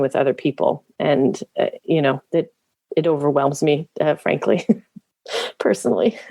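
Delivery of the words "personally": giggling nervously through the word; a nervous little laugh, high and quick. Real, not acted. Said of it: "That's my nervous laugh".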